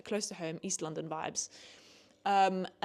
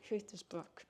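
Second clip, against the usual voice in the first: softer, breathy repeat of I hate this book; much softer muttered repeat; hushed repeat; 11 dB quieter than usual. Real, not acted. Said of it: "I'm so angry".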